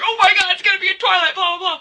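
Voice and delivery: overexcited fangirl shriek, jokingly called calm; squealing fangirl. Retold or imitated. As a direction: squeal it like an obsessed fan. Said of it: "I repeated it in a calm manner".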